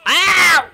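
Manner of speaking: very high, loud cry of pain; pained shriek. Acted. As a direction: shriek as teeth sink in. Gator bites him.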